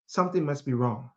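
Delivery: ordinary talk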